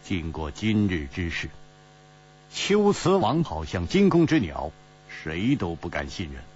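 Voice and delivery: low, understated explanation; cool, faint smile. A narrator's reading. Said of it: "淡淡一笑说".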